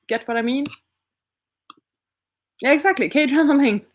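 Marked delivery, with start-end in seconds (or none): 2.6-4.0 laughing. Real, not acted. Laughing at the joke.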